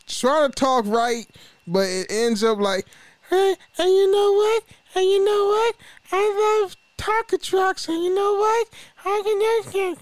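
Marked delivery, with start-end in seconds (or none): 3.3-9.9 much higher mock little boy babbling; cartoon kid rambling, high and squeaky. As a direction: play a small kid who can't talk right. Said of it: "little kids that don't know how to talk right".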